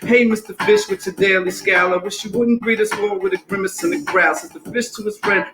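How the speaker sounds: lively singsong rhyme over a beat; rhythmic story reading. Acted. Reading Pout Pout Fish to the audience, rhythmic story reading. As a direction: read it bouncy, riding the rhythm.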